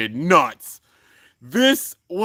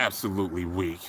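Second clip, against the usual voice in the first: low, quiet villain dismissal; mock-villain disdain, hushed and low; menacing contempt; 10 semitones lower, 8 dB quieter than usual. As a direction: drop deep and soft, full of scorn. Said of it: "Lane's just looking menacing".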